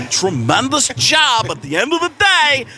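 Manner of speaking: high, excited analyst voice; hammy draft-pundit rant; sports analyst voice. Retold or imitated. Continuing the Kiper impression.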